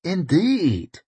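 significant. Acted. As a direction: let it hang knowingly. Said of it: "said Koska significantly".

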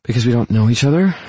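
hesitant, serious woman building up to a confession; serious, strained. A narrator's reading. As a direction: ask it with mild strain. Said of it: "Her voice is serious, if not strained".

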